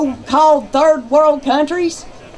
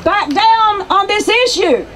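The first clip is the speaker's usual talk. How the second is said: defiant rally cry; 5 semitones higher than usual. Real, not acted.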